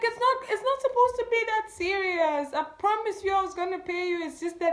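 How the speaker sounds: high, pleading plea of innocence; pleading and upset. Retold or imitated. Pleading with the niece over the debt, high and upset. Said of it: "and I was so sad".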